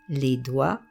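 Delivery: normal talking voice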